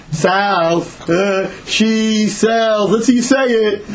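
slow, drawn-out mocking schoolkid voice; imitating children jeering at a lisp; taunting kids. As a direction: stretch the tongue-twister to tease. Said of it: "kids have no pity".

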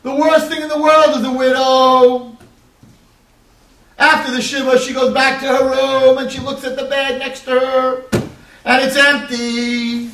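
high, loud mournful sermon voice; impassioned preaching. Acted. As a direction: preach the widow's grief at full force. Preaches the widow's grief.

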